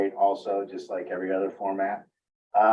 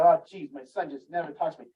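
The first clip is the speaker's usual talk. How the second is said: complaining; 6 semitones higher than usual. Retold or imitated. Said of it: "if you say it in a complaint".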